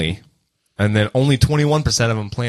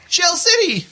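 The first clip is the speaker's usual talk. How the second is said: high squeaky cartoon voice; slower than usual. Quoting the SpongeBob movie.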